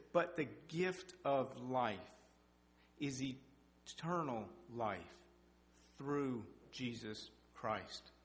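very slow, each phrase set apart by pauses; solemn scripture reading. Acted. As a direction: lay out the verse word by word, slowly. Quoting scripture slowly.

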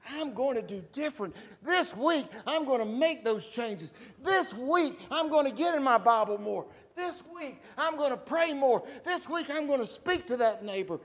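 rising, pumped-up promises; eager resolutions. Acted. Congregation's weekly resolutions voiced.

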